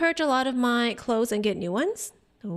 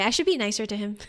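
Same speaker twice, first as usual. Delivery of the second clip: ordinary talk, nothing marked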